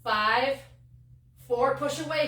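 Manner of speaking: ordinary talk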